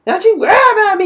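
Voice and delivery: fast, high, loud rattled-off retort; rapid defiant repeat. Retold or imitated. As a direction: spit it out fast and sharp. Nova repeats retort faster.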